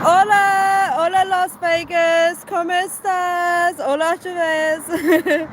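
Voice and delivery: high, slow, loud hola; excited greeting. Real, not acted. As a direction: sing out a cheerful hello. Greeting Las Vegas viewers in chat.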